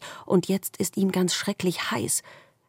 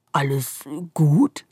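worried darkness; slower than usual. A narrator's reading. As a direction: check on the squirrel gently. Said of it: "fragt die Dunkelheit besorgt".

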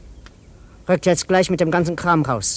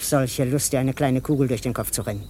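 low menace; 6 semitones lower, 5 dB quieter than usual. Acted. Gunman threatens his former partner, low and quietly menacing.

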